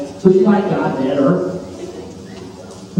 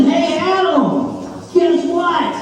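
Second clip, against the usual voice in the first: higher, slow, playful call; sing-song Eve; 9 semitones higher, much slower than usual. Acted. Eve calling playfully to Adam with the fruit. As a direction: lure him in with a teasing lilt.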